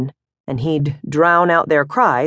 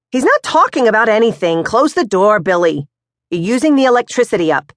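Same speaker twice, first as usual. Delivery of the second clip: higher, louder, quicker mom scolding the fridge door; bustling mother Moms; 5 semitones higher, 4 dB louder than usual. Acted. Mother to Billy, scolding him about the icebox. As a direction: cover for him, hurry on.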